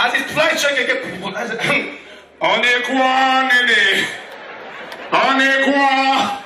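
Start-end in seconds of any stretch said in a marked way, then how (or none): 2.4-6.4 stretched, high mock announcement; slow sing-song boarding call; slow drawn-out call. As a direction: drag each word out like a tannoy. Comic drawn-out announcer quote.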